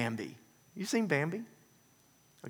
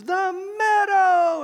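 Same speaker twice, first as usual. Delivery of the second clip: excited cry; 9 dB louder, much slower than usual. Retold or imitated. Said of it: "And Bambi goes".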